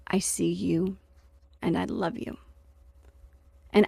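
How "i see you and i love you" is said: quiet, tender words said almost under the breath; a gentle voice from her heart; soft inner voice. Acted. Her heart speaking to her through the dog, softly and tenderly.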